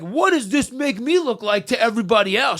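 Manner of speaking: mock-petty worrying about how he looks; selfish inner voice. Magician mocking his selfish self, a petty fretful whine. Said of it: "selfish me is like".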